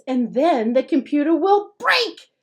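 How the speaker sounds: big brother scaring the little one; fierce. A narrator's reading. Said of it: "says Frank fiercely".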